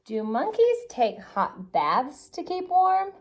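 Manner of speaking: usual speaking voice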